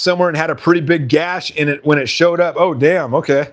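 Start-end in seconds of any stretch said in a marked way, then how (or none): none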